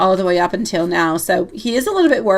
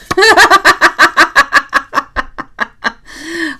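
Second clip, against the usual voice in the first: laugh; 8 semitones higher, 5 dB louder than usual. Real, not acted. Laughs at a message.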